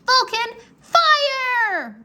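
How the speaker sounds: high, loud battle command; yelled. A narrator's reading. Rory ordering Vulcan to attack, a high loud yell. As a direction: shout the order at the dragon. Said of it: "Rory yelled".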